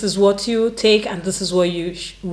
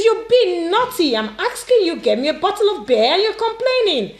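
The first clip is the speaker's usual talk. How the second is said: high-pitched, indignant telling-off; scolding auntie; 8 semitones higher than usual. Acted. Auntie scolding a niece who refuses an errand, high-pitched. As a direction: scold in a raised pitch, affronted.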